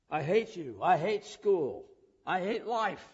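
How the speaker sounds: loud, rising angry outburst; retold kid's scream. Teacher recalls a student screaming at him, loud and angry. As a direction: yell it like a furious kid. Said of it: "kids stand screaming at me".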